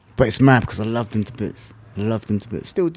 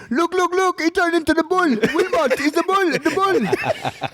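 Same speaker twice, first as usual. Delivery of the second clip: much higher, mock-excited voice of Amir laughing; high-pitched teasing impression of the coach; mimicking Amir; slower than usual. Coach announcing the nickname to the gym, high teasing voice. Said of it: "Amir turns around and says".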